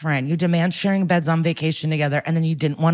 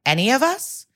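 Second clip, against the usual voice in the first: higher, rising echo question; pointed disbelief; 6 semitones higher than usual. Retold or imitated. Questioner catches the wife's slip, mock incredulous. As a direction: imitate a questioner pouncing on a slip.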